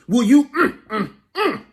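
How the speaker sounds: high, appreciative mm-mm-mm over a tasty bite; savoring hum. Acted. Poet savoring soul food for the audience, delighted humming.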